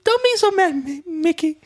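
high squeaky cartoon mouse; Minnie Mouse voice. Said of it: "then Minnie Mouse".

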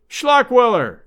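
loud, high shout of a name; shouting boss. Acted. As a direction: shout across the shop. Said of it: "shouted the voice of the boss".

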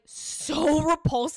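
slow, stretched, disgusted "so repulsed". Real, not acted. Telling the hookup story, slow disgusted drawl. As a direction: drag out the disgust. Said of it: "And I am".

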